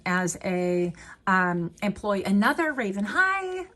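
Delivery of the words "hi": very high cooing hi, then a laugh; giddy, tiny hello; delighted squeal. Real, not acted. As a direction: greet the bird like a pet. Greeting the raven card.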